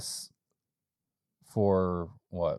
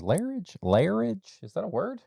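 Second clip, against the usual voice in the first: surprised; 11 semitones higher than usual. Real, not acted. Questioning made-up word.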